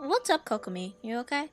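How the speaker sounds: ordinary talk, nothing marked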